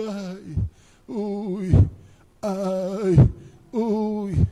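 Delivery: mock pained moans of an injured woman; lightly voiced hospital moaning; imitating moaning. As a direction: whimper ai and ui like a hurt patient. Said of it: "E a outra chorando".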